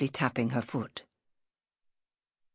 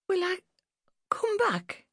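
put-on tiny, very high child voice; anxious little girl. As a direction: ask haltingly, almost whispering.

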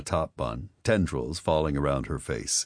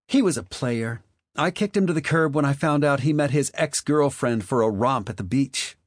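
put-on raised, chatty party girl; a higher, indignant gossip; high, gossipy Marley voice; 10 semitones higher, 4 dB louder than usual. Marley complaining about her boyfriend.

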